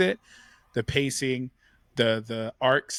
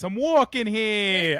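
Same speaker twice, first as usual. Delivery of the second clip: put-on high, stretched-out New York accent; 6 semitones higher, 3 dB louder, much slower than usual. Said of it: "with that accent".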